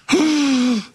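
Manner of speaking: high, audible intake of breath; mock shocked gasp. Preacher reacts to "according to works", mock shocked gasp. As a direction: gasp as if scandalized.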